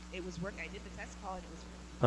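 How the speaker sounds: quiet, broken-up call audio; cutting out. Real, not acted. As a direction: voice dropping away on a bad mic. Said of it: "the mic is cutting out".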